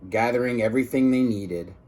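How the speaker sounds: plain reading voice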